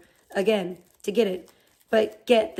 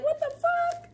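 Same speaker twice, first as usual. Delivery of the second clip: much higher, put-on squeal of disgust; high-pitched mock outrage; disgusted shock; 4 dB quieter than usual. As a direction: shriek it up high at moldy food. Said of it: "I was like".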